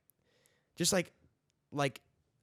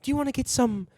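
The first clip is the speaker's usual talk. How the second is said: fake British accent; 9 semitones higher, 3 dB louder than usual. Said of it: "Their accent is fake".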